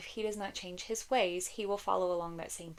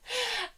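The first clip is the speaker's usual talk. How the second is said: short laugh; 14 semitones higher than usual. Real, not acted. Nervous laugh about long videos.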